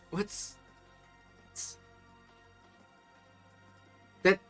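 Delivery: ordinary delivery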